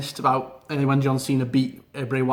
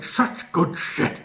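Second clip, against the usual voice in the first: puppet Vince; 3 semitones higher, much slower than usual. Retold or imitated. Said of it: "puppet version of Vince going".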